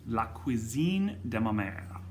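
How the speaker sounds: a self-aware, hammed-up French accent on the wine name; terrible French accent. Naming the red wine for the club in a bad French accent. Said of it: "that was a terrible French accent".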